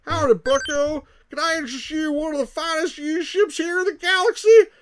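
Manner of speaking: loud, high-pitched Texan used-ship salesman; Texan accent. Acted. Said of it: "like, a Texan accent".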